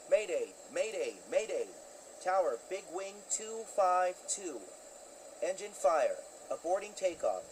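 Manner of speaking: tense pilot calling the tower over the radio; pilot cockpit headset. Acted. Mayday call, engine fire.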